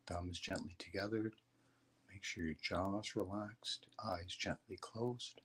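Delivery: soft, low meditation guidance; guided meditation. Acted. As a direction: calmly guide them into stillness. Leads a meditation.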